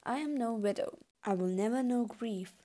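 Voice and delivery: ordinary talk, nothing marked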